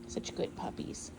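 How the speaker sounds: whispered praise, slow and hushed; hushed, unvoiced praise for good puppies; soft whisper. Real, not acted. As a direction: breathe it to the puppies without waking them. Praising puppies softly while filming.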